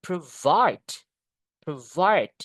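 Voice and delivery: "Provide" with a quick "pro" and punched, falling "vide"; stress on second syllable. Shown as a demonstration. Teacher drilling a verb, stress on "vide" in "Provide". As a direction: hit "vide" in each "Provide". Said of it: "di suku kata yang kedua".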